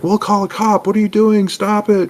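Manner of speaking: high, loud squeaky goblin panic; panicked goblins. Retold or imitated. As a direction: squeal it out like frightened little goblins. Goblins panic when attacked.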